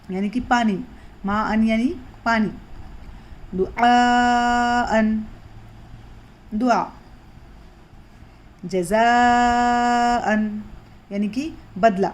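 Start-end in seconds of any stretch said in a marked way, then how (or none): 3.6-5.2 دعاءً with the aa held long before the hamza; long madd recitation. Shown as a demonstration. Teacher recites دعاءً for students, madd stretched long. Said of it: "مد اصلی جو ہم نے سیکھے تھے". 8.6-10.6 دعاءً with the aa held long before the hamza; long madd recitation. Shown as a demonstration. Teacher recites دعاءً for students, madd stretched long. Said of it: "مد اصلی جو ہم نے سیکھے تھے".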